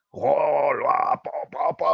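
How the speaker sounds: high, loud smashing roar with punch noises; drawn-out roar, then punchy pow sounds; Hulk. Acted. Boy plays with his Hulk doll, roaring and punching. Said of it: "su muñeco de Hulk".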